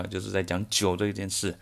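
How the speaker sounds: ordinary talk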